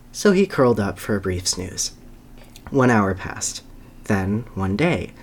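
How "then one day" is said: ordinary talk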